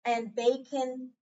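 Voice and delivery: slow, each letter drawn out with a rising tune; slow card reading. Shown as a demonstration. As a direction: read each card slowly, stretching every vowel name. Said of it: "let me go over the cards again just to remind you".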